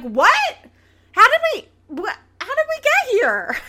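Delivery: high, loud, incredulous shriek of confusion; stunned disbelief. Retold or imitated. Podcast host mimicking her baffled reaction to the couple, high loud disbelief. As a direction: shriek it like you cannot believe your ears. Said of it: "And I'm like".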